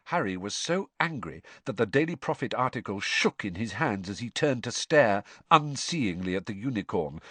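usual speaking voice

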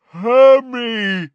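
high, very loud giant roar. A narrator's reading. Giant calls to terrified girl, roaring. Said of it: "roared Grawp".

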